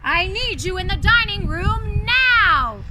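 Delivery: loud, slow, commanding roar; beastly bellow. Acted. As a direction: summon them with a big slow bellow. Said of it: "he bellowed".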